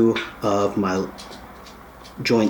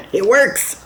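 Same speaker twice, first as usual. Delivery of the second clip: high, delighted cry through the voice changer; thrilled little shout; excited; 11 semitones higher than usual. Real, not acted. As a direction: celebrate the gadget working. Kit comes alive, voice changer.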